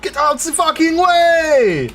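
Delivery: high, shouting mock accent; German-Japanese accent. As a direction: yell it in a hammy foreign accent. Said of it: "Japanese, German".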